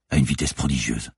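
ordinary delivery

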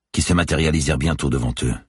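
ordinary delivery

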